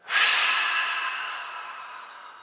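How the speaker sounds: long, deep breath out through the mouth; teacher demonstrating a slow relaxing exhale; audible exhale. Shown as a demonstration. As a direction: let a big releasing sigh go. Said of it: "exhaling out your mouth".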